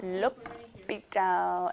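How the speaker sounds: L'Hopital drawn out slowly, lo-pee-tah, French style; mock French L'Hopital. Shown as a demonstration. Teacher to class, teasing the French speakers, slow mock French. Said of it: "Any Frenchies?".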